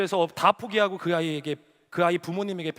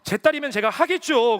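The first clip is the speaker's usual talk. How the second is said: raised, louder mock indignation; retold angry protest; 4 semitones higher, 7 dB louder than usual. Pastor protesting to God about the daughter, retold angry protest. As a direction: argue back, imitating an exasperated man. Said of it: "하나님한테 역정을 내면서".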